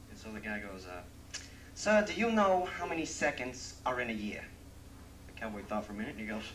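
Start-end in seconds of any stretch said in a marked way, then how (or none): 1.8-4.4 higher, louder, smug question; spring the trick question; city guy quiz. Acted. New Yorker asks riddle.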